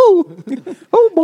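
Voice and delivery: squeaky high cartoon mouse; tiny cartoon critter voice, way up high. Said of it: "cartoon mouse crawling out of a champagne bottle".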